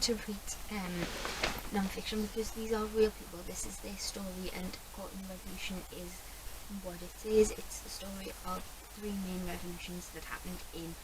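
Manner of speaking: hushed Saturday-morning talk; whispering. Real, not acted. Whispered early-morning vlog.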